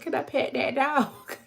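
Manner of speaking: high, mocking country toddler voice; mimicking the toddler quote. Streamer mimics friends downstairs interrupting her reading, high country voice. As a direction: imitate the friends' silly high-pitched yelling. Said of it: "they down there talking about".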